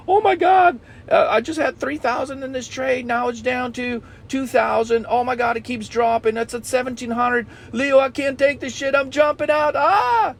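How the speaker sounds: high-pitched panicked whine rising to a yelp; panicky whiny trader. Retold or imitated. Coach mocks a student panicking over a dropping trade, high shrill squeal. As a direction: squeal like a scared trader watching a loss. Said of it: "like a little bitch".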